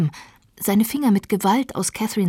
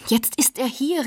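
higher, urgent warning; alarmed exclamation; 7 semitones higher than usual. A narrator's reading. Servant warns lovers the master is here, alarmed. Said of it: "rief ich aus".

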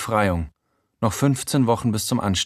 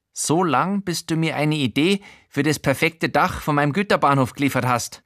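high odd man's voice, eager; a higher, earnest hobbyist rambling; Showalter's voice; 6 semitones higher than usual. Acted. Showalter explaining his model railway roof, higher eager voice.

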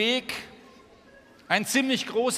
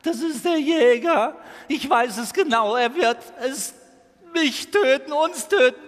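high, loud, frantic terror; panicked stag; 5 semitones higher, 5 dB louder than usual. Acted. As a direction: scream in panic, begging them to run. Panicked stag begs them flee.

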